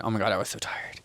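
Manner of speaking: breathy, worn-out whisper; exhausted whisper. Retold or imitated. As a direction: sigh it out like you're drained. Recalling being exhausted.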